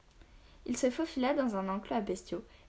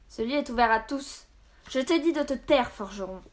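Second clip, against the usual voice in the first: raised, commanding storybook captain; acted anger, louder and higher on the command; angry rebuke; 4 semitones higher, 7 dB louder than usual. A narrator's reading.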